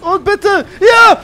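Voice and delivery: high, loud burst of elation; triumphant shout. Real, not acted. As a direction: shout it out as the plan works. Car finally loaded into plane. (triumphierender Jubelschrei)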